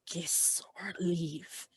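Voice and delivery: very slow, drawn-out spectral whisper; ghostly whisper. Acted. Spectre giving two friends an ultimatum, slowly whispered. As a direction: stretch each word out in a voiceless whisper.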